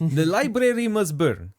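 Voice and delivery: slow, put-on Russian accent; drawn-out mock Russian accent. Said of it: "tried to do the Russian accent".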